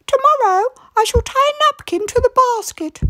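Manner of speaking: put-on high, bright old lady voice; wife proposing a plan to her husband; Missus Grinling. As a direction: play a brisk little old wife.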